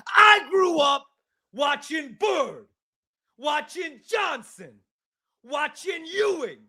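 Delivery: put-on high shouted New York accent, slow listing; mock New York talk radio caller, outraged; New York caller rant. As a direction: yell like a nostalgic Knicks fan.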